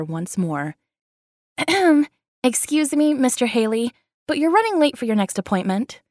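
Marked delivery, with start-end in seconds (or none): 1.6-2.1 loud, deliberate throat clear; pointed throat clear. Acted. Interrupting from doorway. 2.4-6.0 higher, cool and pointed; politely cutting in, clearly annoyed; unamused. Acted. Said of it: "her voice unamused".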